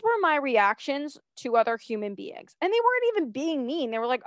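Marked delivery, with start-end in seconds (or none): none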